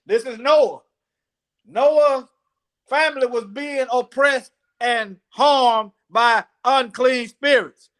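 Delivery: slow, measured delivery, word by word; slow teacher's recital; scripture teaching. Acted. Introduces the scripture story.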